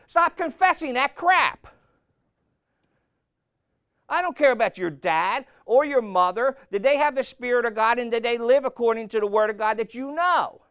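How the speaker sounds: loud, high, driving sermon voice; preaching at full force. Acted. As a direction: hammer it home from the pulpit.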